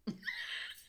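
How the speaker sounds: high unvoiced squeal of laughter; squeaky laugh. Real, not acted. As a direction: burst into a silent squeaky laugh. Laughing at own story.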